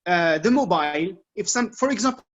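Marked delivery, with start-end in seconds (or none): 0.4-1.2 "mobile" as MOH-bile, second syllable like "bile"; correct English pronunciation. Shown as a demonstration. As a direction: say "mobile" with a long i in the ending. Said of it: "because we say mobile".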